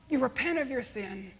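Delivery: ordinary talk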